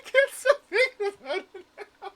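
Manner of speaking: very high squeal through laughter; helpless, wheezy real laughter; cracking up. Real, not acted. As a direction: squeeze the words out while laughing hard. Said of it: "it's really funny to me".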